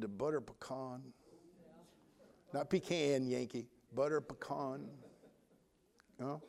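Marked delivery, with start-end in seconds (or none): 2.5-3.3 pecan said PEE-can, the rejected Northern way; pecan with a short-a second syllable, quietly dismissed; Yankee pecan. Shown as a demonstration. Preacher correcting a Yankee, pecan said the wrong way. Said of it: "Not pecan, Yankee". 3.9-4.9 pecan said puh-KAHN; butter pecan with a long, open second syllable, softly; Southern pecan. Shown as a demonstration. Preacher correcting a Yankee, pecan said puh-KAHN. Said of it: "Not pecan, Yankee".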